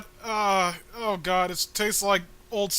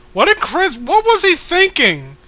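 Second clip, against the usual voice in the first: much higher and louder, disbelieving rant; incredulous outburst; 6 semitones higher, 8 dB louder than usual. Real, not acted. Outraged at the comic's author.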